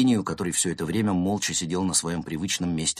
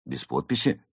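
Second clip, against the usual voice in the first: plain reading voice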